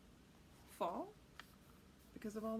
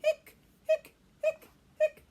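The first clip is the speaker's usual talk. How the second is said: hiccup sounds; 15 dB louder, slower than usual. Acted. Hiccups jumping away.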